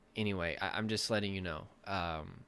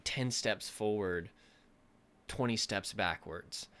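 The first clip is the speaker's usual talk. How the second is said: slow emphasis; slower than usual. Real, not acted. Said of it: "slowed emphatic phrase".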